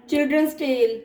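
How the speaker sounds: ordinary talk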